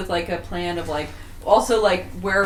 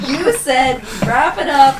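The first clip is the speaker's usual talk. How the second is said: tag; 5 semitones higher, 6 dB louder, slower than usual. Acted. Acted quarrel as pregnant wife.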